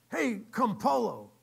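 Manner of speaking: loud, high, slow call-out; accusing challenge. Retold or imitated. Diner owner challenging Campolo, accusing.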